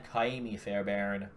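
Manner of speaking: name said with American r's in Fairbairn; drawn-out Kaimi Fairbairn in an American accent. Shown as a demonstration. Reading off a drafted kicker's name, in an American accent. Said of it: "say that one with an American accent".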